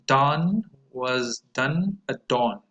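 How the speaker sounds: very slow, each vowel clear; slow model sentence. Shown as a demonstration. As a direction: say it slowly for students to repeat. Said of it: "pronounce them after me".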